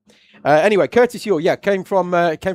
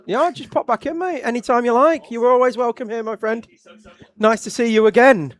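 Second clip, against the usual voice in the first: cheery sendoff; 9 semitones higher, 4 dB louder than usual. Real, not acted. Host farewells guest warmly.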